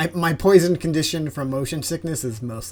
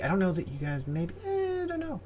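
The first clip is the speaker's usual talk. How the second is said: high, whimpering squirrel plea; whiny plea; 6 semitones higher, 6 dB quieter than usual. Acted. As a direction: beg with a whine. Squirrel pleading for the plushie.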